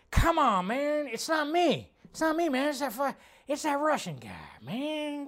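high put-on voice, whiny denial; mock politician voice.

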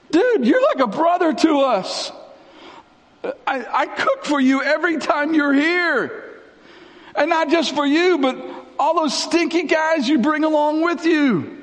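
high-pitched, hammed-up grievance; mock indignant complaint. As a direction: whine like an unappreciated host. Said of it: "Mary and Martha are like".

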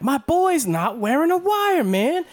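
high, slow, drawn-out mock plea; mock Damon Wayans. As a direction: stretch it out high like a desperate cop. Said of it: "where he's like".

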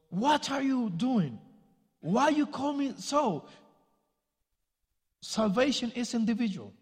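retold angry outburst. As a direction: imitate the son snapping at his mother. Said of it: "he got mad at his mom and goes".

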